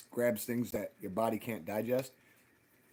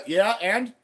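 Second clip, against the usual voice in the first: louder, higher, challenging comeback; mock defiant retort; 7 semitones higher, 8 dB louder than usual. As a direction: imitate a cocky so-what. Retells his defiant comeback.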